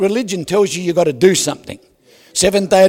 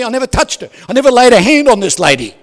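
loud protest; 4 semitones higher, 8 dB louder, faster than usual. Real, not acted. Protesting innocence to the crowd.

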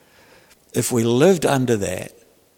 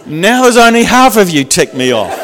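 punchline said through laughter, louder and higher; laughing; 7 semitones higher, 10 dB louder than usual. Real, not acted. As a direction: laugh your way through the joke.

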